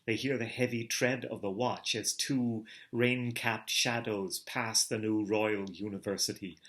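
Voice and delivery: plain reading voice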